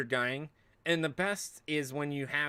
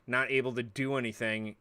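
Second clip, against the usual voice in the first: ordinary talk, nothing marked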